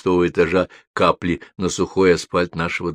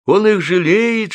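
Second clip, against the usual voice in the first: crowd of high little voices; rustling murmurs; 11 semitones higher, 5 dB louder, slower than usual. A narrator's reading. Umbrellas and raincoats pass remarks along, high-pitched murmuring. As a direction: voice separate onlookers, pitch raised. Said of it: "шуршат зонты и плащи".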